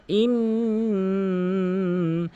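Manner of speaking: ইন with a very long nasal hum; obligatory ghunna. Shown as a demonstration. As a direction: stretch the ন of ইন through the nose. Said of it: "নাকের ভিতরে নিয়ে আওয়াজ করা". (ইন নাকে টেনে গুণনা)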